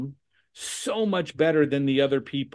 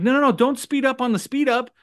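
higher-pitched mock objection; mock protest; 9 semitones higher, 3 dB louder than usual. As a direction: protest as if begging them to stop. Acts out flustered caller's protest.

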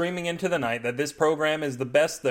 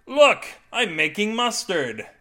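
high, loud opening cry, then slower boast; excited showing off; 8 semitones higher, 5 dB louder, slower than usual. A narrator's reading. Husband showing wife his mustard, excited crowing. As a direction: crow over a silly achievement.

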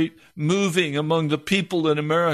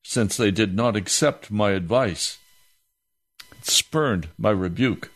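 solemn scripture reading; 6 semitones lower than usual. Acted. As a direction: let each phrase of Proverbs land heavily. Reads scripture before praying.

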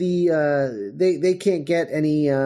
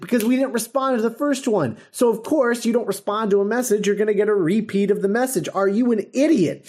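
higher-pitched, lightly voiced impatient Doctor; sassy Doctor; 6 semitones higher than usual. Recapping the Doctor scolding the space control guy, mildly sassy. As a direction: toss it off like he's talking to a fool. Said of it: "Pertwee is really sassy this whole story".

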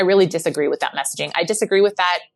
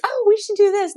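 cheery suggestion. Retold or imitated. Bright suggestion while running.

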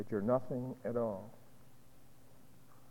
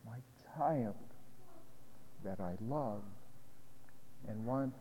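slow, soft and loving; tender divine voice; 5 dB quieter than usual. Acted. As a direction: speak to a beloved child. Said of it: "For he's here to say".

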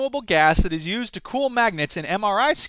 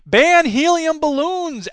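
slow, higher dramatic headline read; higher slow news-headline voice; mock headline; 7 semitones higher, 4 dB louder, much slower than usual. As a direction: announce the headline with mock gravity. Reading a headline mockingly.

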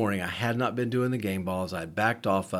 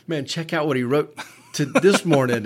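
raised, higher voice calling someone over; mock yell through the house, half laughing; retold yelling; 5 semitones higher, 7 dB louder than usual. Man calling Dina to read the game ball, mock yelling. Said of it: "yelling for Dina to come".

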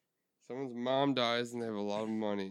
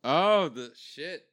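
surprised; 5 semitones higher, 5 dB louder, much slower than usual. Real, not acted. Reacting to the answer.

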